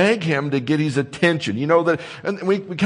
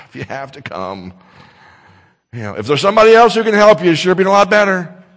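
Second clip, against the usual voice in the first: loud, hurried, reluctant offer; grudging, put-out voice; 4 semitones higher, 8 dB louder, faster than usual. Retold or imitated. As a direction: sound like helping is a bother. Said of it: "He doesn't say".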